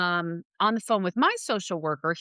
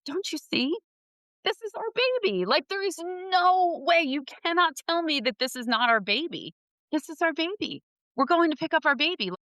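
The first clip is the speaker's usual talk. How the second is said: excited; 9 semitones higher than usual. Retold or imitated. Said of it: "I'm like".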